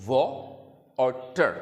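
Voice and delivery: वॉ and टर said as two separate beats; split into syllables. Shown as a demonstration. Teacher splits the word for children, syllable by syllable. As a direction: break वाटर into वॉ then टर. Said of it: "इसमें दो syllable हैं".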